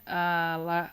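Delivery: ordinary talk, nothing marked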